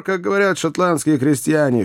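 high, cheery aside; joyful crying out. A narrator's reading. Joe explains his Scottish cheer to companions, joyfully. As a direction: keep the glee up, pitched high. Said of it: "продолжал радостно кричать Джо".

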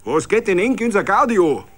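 high, loud defiant yell; angry retort. A narrator's reading. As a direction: throw it back in his face. Smith yells at SA man.

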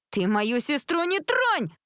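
high, drawn-out indignant shriek; squealed warning. A narrator's reading. Jessica defending her sister from insults, squealing. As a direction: shriek it in protest, stretching the last word. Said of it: "взвизгнула Джессика".

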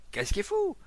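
very high, loud question; alarmed bystander's question; startled shout. Acted. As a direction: shout it in shrill disbelief. Crowd member shouts a question.

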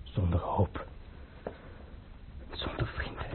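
whispered, all voice gone; despairing whisper. Acted. Abandoned prince to himself, hopeless whisper. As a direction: breathe it out with nothing left.

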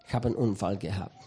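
low, soft, guilty mumble; sheepish husband. Retold or imitated. Husband admitting the wreck to his wife, low and sheepish. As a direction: confess it small and ashamed.

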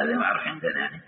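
normal talking voice

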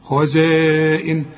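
خزائن very slow, long-held "aa" before the hamza; obligatory madd. Shown as a demonstration. Teacher demonstrates the required madd, long held vowel. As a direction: stretch the alif of خزائن on a level note. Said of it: "المد وبعديه همزة".

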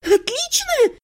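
high-pitched, outraged echo; indignant squeal. A narrator's reading. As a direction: squeal it back in disbelief. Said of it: "взвизгнула я". (возмущённый визг)

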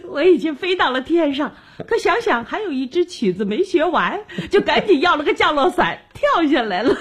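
talking through happy laughter; laughing. Acted. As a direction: giggle through the joke. Joking about the parachute.

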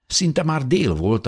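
usual speaking voice

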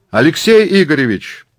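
high, loud hail; shout. Acted. Murat spots the academician, shouting to him. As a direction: call out over the crowd. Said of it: "кричит Мурат".

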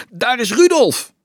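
high, loud excited shout; calling out. A narrator's reading. Friend spotting Rudolf in the crowd, calling out loudly. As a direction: call it out over a crowd. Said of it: "klonk een bekende stem".

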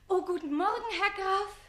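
high, bright innocent greeting; feigned sweetness. Acted. Susanne hiding the page, sweet feigned greeting. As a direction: greet him all innocence. Covering up for the count.

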